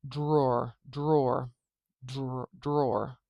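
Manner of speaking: drawer, drawer as one syllable, like door with a d; slow, deliberate model word; careful demo. Shown as a demonstration. Teacher modelling the word for transcription. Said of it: "open our transcription bracket".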